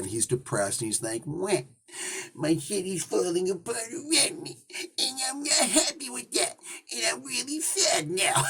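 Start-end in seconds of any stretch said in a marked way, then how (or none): 1.3-8.4 high, whiny mock sobbing as a sulking Kingpin; mock sad whine. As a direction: whimper it like an overgrown crybaby. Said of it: "like, really sad".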